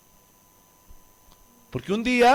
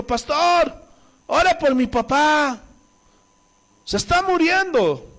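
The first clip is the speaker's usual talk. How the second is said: raised, urgent mock plea; high-pitched imitation of a distressed relative; pleading relative; 7 semitones higher, slower than usual. Family begs the pastor for dying father, imitated urgent plea.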